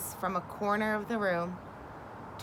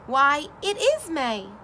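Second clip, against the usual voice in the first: surprised realization; 5 semitones higher, 5 dB louder than usual. Acted. Toad realizes it is May.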